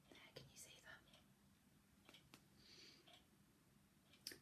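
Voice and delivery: whispered aside to the little listener; soft whispered aside. Real, not acted. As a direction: hush it, pointing at the page. Aside to child looking at picture.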